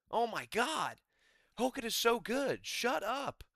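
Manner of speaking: high, sarcastic fanboy gush; mock gushing. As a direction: gush over him sarcastically.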